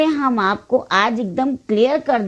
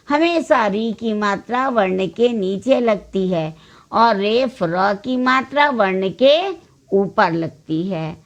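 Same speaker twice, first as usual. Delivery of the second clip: ordinary delivery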